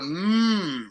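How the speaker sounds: MM as a sustained hum; held M. Shown as a demonstration. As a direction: hold MM steadily with closed lips. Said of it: "You have to hold".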